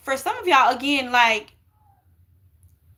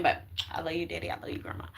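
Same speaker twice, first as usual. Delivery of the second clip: barely voiced, tender goodbye; soft farewell; 5 semitones lower, 11 dB quieter, much faster than usual. Real, not acted. To her late father and grandmother, a soft tearful whisper. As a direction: drop to a near whisper for the last words.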